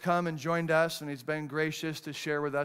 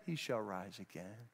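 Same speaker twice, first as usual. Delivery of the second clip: hushed promise; 9 semitones lower, 15 dB quieter than usual. Acted. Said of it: "He wasn't smirking when he said".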